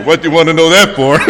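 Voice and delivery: suddenly suspicious of the question; paranoid. Retold or imitated. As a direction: paranoid caller on the phone. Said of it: "I started getting paranoid".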